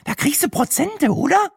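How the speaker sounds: extremely high, squeaky character voice; high caricature voice, hopeful question; eager Brenda. A narrator's reading. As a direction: ask in a keen falsetto. Brenda angles for a discount.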